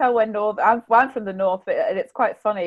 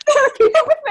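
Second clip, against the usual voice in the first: mock amazed friends; 9 dB louder, faster than usual. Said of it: "they're like".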